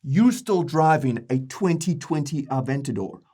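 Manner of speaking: brash, hyped podcast bro voice. Acted. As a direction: play a swaggering crypto bro host. Bros boasting at each other.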